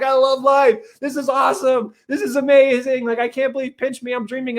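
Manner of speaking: high, loud burst of joy; high-pitched mock-ecstatic exclaiming; elated. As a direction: gush like someone thrilled with life. Acts out elated homecoming.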